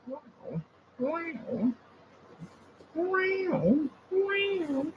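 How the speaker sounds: high soft cooing wow; pet coo. Real, not acted. Man softly cooing wow at his cat.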